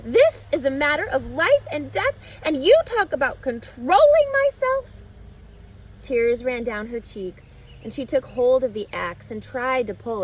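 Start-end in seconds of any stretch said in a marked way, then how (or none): 0.0-5.0 put-on high, loud, rising child's outrage; yelling. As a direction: build to a furious squeal at the end. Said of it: "yelled Fern".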